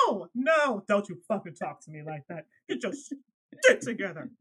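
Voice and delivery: loud stern no, no, cutting her off; angry scolding. Retold or imitated. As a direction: shut her down hard. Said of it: "how I was talking to her".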